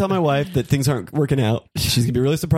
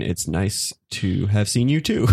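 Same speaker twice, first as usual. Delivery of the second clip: slow typed-out reading; 6 semitones lower, slower than usual. Acted.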